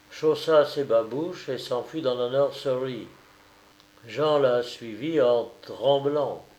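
plain reading voice

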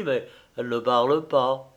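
plain reading voice

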